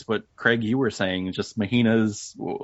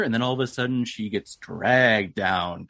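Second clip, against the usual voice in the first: normal talking voice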